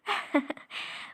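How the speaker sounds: short unvoiced scoffing laugh; soft disbelieving chuckle; breathy laugh. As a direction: laugh off the idea of marriage. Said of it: "मुझे तो हंसी आ रही है।".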